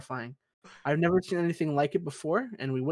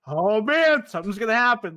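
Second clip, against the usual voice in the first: nervous; 6 semitones higher, 7 dB louder than usual. Retold or imitated. Voicing his nervous self on deck.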